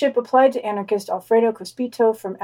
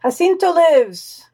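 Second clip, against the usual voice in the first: proclaiming; 7 semitones higher, 5 dB louder, slower than usual. Acted. Proclaiming headline title.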